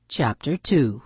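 plain reading voice

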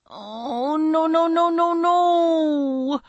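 rising, high drawn-out moan of dismay. Acted. Dana searching her desk for a paperclip, moaning. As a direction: run the words into one long wail. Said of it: "she moaned as she continued to search".

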